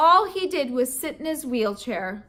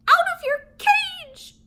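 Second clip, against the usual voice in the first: very high, loud squeaky hamster cry; hamster squeak; 5 dB louder than usual. Acted. Hamster bursting out at grouchy old man, high squeak. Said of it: "I squeaked out loud".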